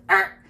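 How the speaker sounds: loud mock growl for the pencil pressing; playful pirate-like arr; growl noise. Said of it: "It goes".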